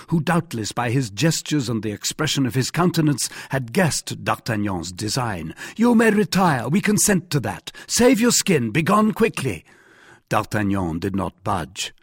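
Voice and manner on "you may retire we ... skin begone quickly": high-pitched, urgent ordering off; imperious command. A narrator's reading. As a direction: order him away in a raised, high voice. Jussac orders the youth off.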